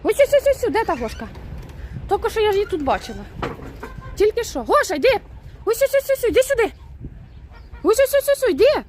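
high singsong calling out; calling the goose. Real, not acted. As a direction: call her over, high and carrying. (висока співуча підкликальна інтонація)

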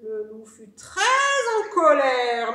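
loud, slow, stretched emphasis; heavy, drawn-out narration; angry storytelling. A narrator's reading. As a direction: swell loudly on the wolf's anger. Narrator voices the wolf's anger.

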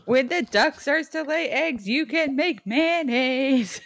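high, slow cartoon villager voice; sing-song NPC reading; game villager voice.

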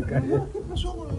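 lightly voiced friend calling out; a hint of a mocking pal; mock greeting. Retold greeting at stadium.

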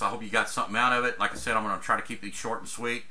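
usual speaking voice

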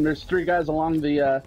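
ordinary talk, nothing marked

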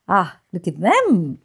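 usual speaking voice

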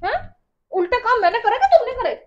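heated quarrel shouting; outraged retort. Real, not acted. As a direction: throw the insult back loudly. Wife throws insult back.

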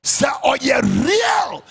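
slow, high, loud shout; preaching shout. Real, not acted. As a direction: drag the words out and roar them.

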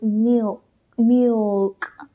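"milk" with a barely-there u glide, miulk; soft little u in milk. Shown as a demonstration. Teacher modelling "milk" with a faint u. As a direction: slip a tiny soft u into "milk". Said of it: "aquí he incluido miu, una pequeña U".

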